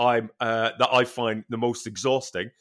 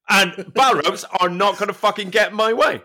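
usual speaking voice

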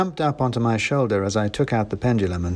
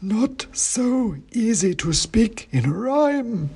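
high, slow, dusty oracle voice; dusty faint ancient head; 11 semitones higher, much slower than usual. A narrator's reading. Ancient king's head answering the wizard, slow high dusty chant. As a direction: intone it like a faint, dusty myth. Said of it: "said Bran".

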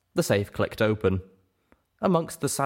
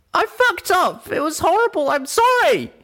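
very high, loud, anguished outburst; tearful yell; 8 dB louder than usual. A narrator's reading. Breaking down before angry friends, high tearful yelling. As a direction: wail the confession at full volume. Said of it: "bursting into tears".

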